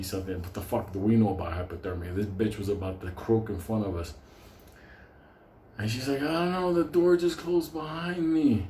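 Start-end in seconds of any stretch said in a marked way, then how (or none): 6.2-8.7 put-on frail old lady. Old lady explains to the narrator, high and helpless.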